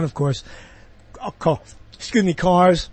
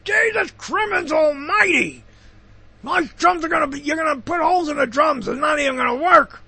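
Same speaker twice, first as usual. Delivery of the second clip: angry father shout; 10 semitones higher than usual. Retold or imitated. Father yelling at his son about Sears drums, angry shout. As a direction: rant like a furious dad hearing Sears. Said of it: "my father said".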